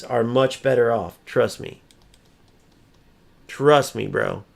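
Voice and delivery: ordinary delivery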